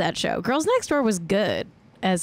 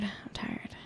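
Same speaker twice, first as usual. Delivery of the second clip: whispered, barely there; 15 dB quieter than usual. Shown as a demonstration. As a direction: breathe it out as a tired whisper. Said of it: "whispered repetition".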